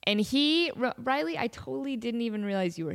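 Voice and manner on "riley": usual speaking voice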